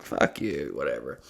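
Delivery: low, soft dismissive mutter; sullen mutter. Retold or imitated. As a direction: drop it under your breath.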